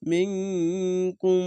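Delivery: slow মিংকুম, nasal ng and final m both held; ikhfa ghunna. Shown as a demonstration. Teacher demonstrating ikhfa ghunna, মিংকুম with long nasal holds. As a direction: stretch মিংকুম on a hummed ng and m. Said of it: "এটি ইকফা গুন্না".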